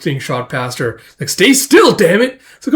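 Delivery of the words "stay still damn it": high, loud mock-angry order; exasperated yell. Shooter yelling at a dodging target, exasperated yell. Retold shooter yells at dodger.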